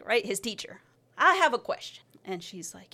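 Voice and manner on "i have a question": high, loud interruption; retold pipe-up with a question; calling out. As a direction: pitch it up and call it out. Calling teacher in class.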